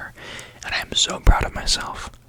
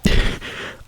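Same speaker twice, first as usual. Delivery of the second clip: whispered giggle; 6 dB louder than usual. Real, not acted. Laughs after a slip.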